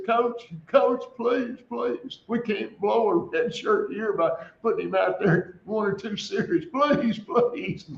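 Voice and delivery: high, pleading retold assistant coach; retold begging assistant. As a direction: beg the head coach, voice rising with each please. Assistant begs head coach.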